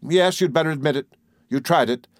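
plain reading voice

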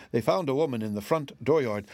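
plain reading voice

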